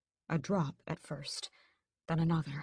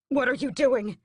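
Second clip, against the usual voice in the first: higher, louder alarmed cry; exclaiming; 8 semitones higher, 8 dB louder than usual. A narrator's reading. Mother exclaiming at son feeding Raoul, alarmed. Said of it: "she exclaims".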